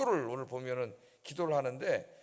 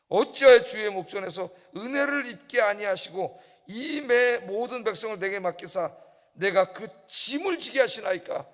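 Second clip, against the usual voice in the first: loud, raised complaint; Moses' lament; 4 semitones higher, 7 dB louder than usual. Acted. Preacher voicing Moses complaining of his burden, loud. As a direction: pour out the burden to God. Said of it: "모세가 여호와께 여짜오되".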